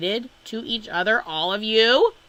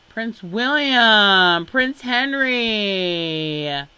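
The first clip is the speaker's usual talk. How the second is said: drawn-out disbelief; 4 semitones higher, 7 dB louder, much slower than usual. Real, not acted. Stunned at royal relatives.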